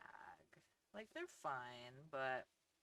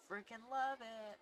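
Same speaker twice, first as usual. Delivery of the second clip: excited; 9 semitones higher, 8 dB louder than usual. Real, not acted. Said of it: "enthusiastic outburst".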